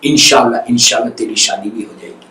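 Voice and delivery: low, soft promise; soft reassurance. Acted. The visitor promising the patient marriage, softly. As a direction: reassure him tenderly. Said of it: "بڑی soft spoken سے".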